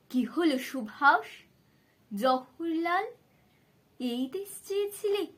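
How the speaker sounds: high, loud, rising question; pained challenging question. A narrator's reading. Old Bapuji asks Subhas and Jawaharlal about their country, pained challenge. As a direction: call them to account, voice climbing. Old man confronts his two companions.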